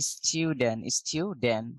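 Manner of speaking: স্টুডেন্ট as "styu-den", no final t; British pronunciation. Shown as a demonstration. Teacher repeating the British way for students, "styu-den". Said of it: "ব্রিটিশরা বলে স্টুডেন্ট".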